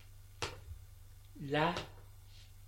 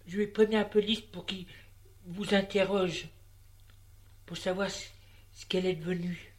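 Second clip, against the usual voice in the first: higher, louder, pressing woman; threatening; 4 semitones higher, 4 dB louder than usual. A narrator's reading. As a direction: push him with the police threat. Madame threatens police.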